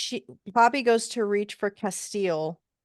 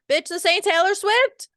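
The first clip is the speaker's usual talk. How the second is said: high, loud indignant outburst; loud comic scolding; mock outrage; 11 semitones higher, 7 dB louder than usual. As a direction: shout it in exasperated disbelief. Mock outrage at quoted line.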